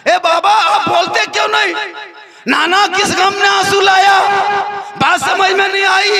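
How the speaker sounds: a frightened son crying out for answers; wailing plea. Acted. Hasan begging his weeping family, high wailing plea. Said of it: "तब हजरत हसन फरमाते हैं".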